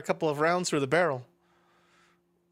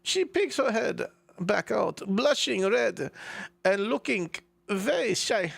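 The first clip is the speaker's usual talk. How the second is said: put-on slow, higher German-accented narration; 4 semitones higher, slower than usual. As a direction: stretch each phrase in a put-on German accent. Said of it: "right, German accent".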